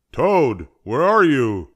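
loud, high searching call; high, loud storybook call. A narrator's reading. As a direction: call into the house for someone. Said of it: "called Frog".

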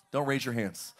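ordinary talk, nothing marked

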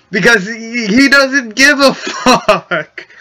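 laughing through the words; cracking up while speaking. Real, not acted. Laughing mid sentence.

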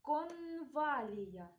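"конвалія" slowly, with a long held N; sound-highlighting pronunciation. Shown as a demonstration. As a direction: linger on the н in "конвалія". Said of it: "та відстежимо спільний звук".

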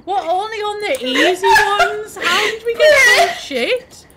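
loud, high, incredulous wail; exasperated lament. Real, not acted. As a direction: complain loudly about how bad you all are, half laughing. Players lament losing at easy levels.

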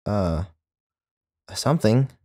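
vague, noncommittal reply; shrugging uncertainty; irresolute. A narrator's reading. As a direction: trail off unsure. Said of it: "with Midwestern irresolution".

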